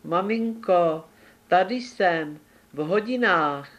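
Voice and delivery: put-on hiding kid answering its mother, scared; timid little voice. A narrator's reading. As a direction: call out small and scared from hiding. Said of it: "ustrašeně slabonký hlásek".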